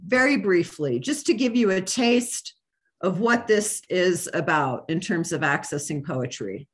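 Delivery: ordinary talk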